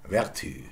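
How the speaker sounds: "vertu" with French front u, ver-TÜ; French "vertu", stressed at the end; French pronunciation. Shown as a demonstration. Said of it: "Take a French word".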